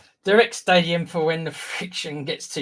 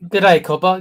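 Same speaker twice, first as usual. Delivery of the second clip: a louder, hammed-up Aussie hello; mock Aussie accent; 3 semitones higher, 8 dB louder, slower than usual. Co-host greeting a fan in mock Aussie accent. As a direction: greet him like a cheery Aussie, loudly. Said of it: "Gotta call him Cobber".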